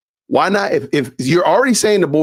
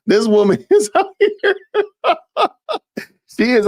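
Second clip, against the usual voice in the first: high squealing laugh breaking the words; can barely get it out for laughing; laughing hard; 13 semitones higher than usual. Real, not acted. Host mocking Candace's story, laughing hard.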